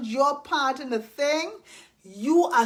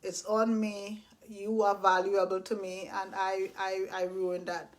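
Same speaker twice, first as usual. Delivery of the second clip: soft sincere apology; 5 semitones lower, 5 dB quieter than usual. Retold or imitated. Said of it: "I get to say that".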